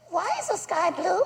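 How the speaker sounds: put-on high, soft kid voice; curious child. Three-year-old son asking dad, high curious voice. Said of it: "when they ask you about everything".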